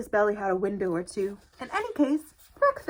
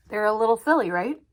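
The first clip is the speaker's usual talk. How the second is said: ordinary delivery, nothing marked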